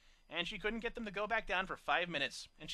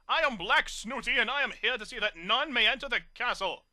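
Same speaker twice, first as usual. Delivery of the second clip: snobbish; 5 semitones higher, 8 dB louder than usual. A narrator's reading. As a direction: announce yourself grandly. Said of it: "his voice all snobbish".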